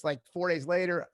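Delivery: ordinary delivery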